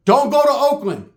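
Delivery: loud, high-pitched warning; emphatic warning. Real, not acted. Comic warns you guys about Oakland, loud emphatic warning. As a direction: punch it out as an urgent caution.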